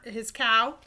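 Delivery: ordinary delivery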